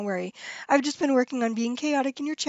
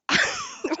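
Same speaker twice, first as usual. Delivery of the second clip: a sudden high-pitched squealing laugh; high squeal of laughter. Real, not acted. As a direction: let a shrieking giggle burst out. Bursts out laughing at chat joke.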